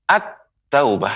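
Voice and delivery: slow "at-taubah", lam melted into the t; "at-taubah" modelled slowly without any l; assimilated lam. Shown as a demonstration. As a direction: linger on the doubled t of "at-taubah". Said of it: "dilebur ke huruf ta".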